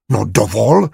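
mild roar. Acted. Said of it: "zařval a praštil lžící".

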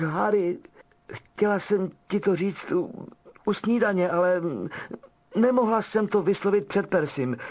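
put-on frightened girl's tearful confession. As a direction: blurt it out through fresh tears. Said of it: "vyděšeně vyjekla a po tváři se jí začaly řinout slzy".

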